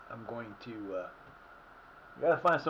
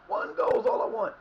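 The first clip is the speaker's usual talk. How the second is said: pleading shout; 10 semitones higher, 7 dB louder than usual. Real, not acted. Pleading with his players.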